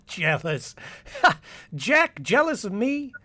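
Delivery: amused disbelieving laugh through the words. Acted. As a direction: laugh the idea off. Paul laughs off jealousy.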